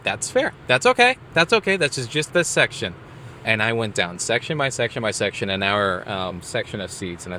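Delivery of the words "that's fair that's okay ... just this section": forced cheery reassurance. Retold or imitated. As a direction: sound fine with it though you're not. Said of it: "And I was like".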